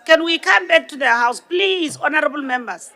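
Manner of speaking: usual speaking voice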